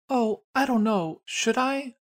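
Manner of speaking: put-on high, meek, dithering girl voice; mocking a JRPG girl with a high, unsure voice; timid small woman. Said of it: "she's always like".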